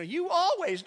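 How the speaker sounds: high, loud mock "You always"; mock accusation. Pastor imitates a spouse's blame, mock accusing. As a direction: toss out a blaming "You always". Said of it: "No, don't say".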